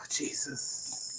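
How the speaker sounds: breathless exasperated whisper; whispered Jesus. Real, not acted. Host worn out from traffic, whispered exasperation. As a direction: sigh the word out with no voice.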